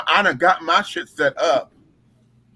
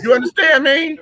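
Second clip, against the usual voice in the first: shouting; 9 semitones higher, 4 dB louder than usual. Real, not acted. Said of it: "emphatic shout to audience".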